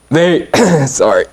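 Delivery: coughing through the words; choking on a bug mid-sentence; just swallowed a bug. Real, not acted. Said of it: "I don't know if I swallowed a bug".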